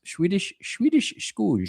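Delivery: put-on Dutch accent. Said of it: "I'm actually doing a Dutch accent there".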